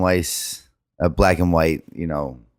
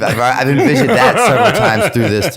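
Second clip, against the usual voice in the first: higher and louder, laughing through it; sheepish laughing admission; 10 semitones higher, 8 dB louder than usual. Real, not acted. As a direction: confess it while cracking up. Admits relating to screaming man.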